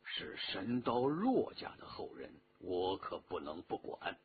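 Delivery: low, soft, pensive murmur; quiet, deep-pitched musing aloud; talking to himself. A narrator's reading. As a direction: murmur it inwardly, dropping very low. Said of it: "自言自语".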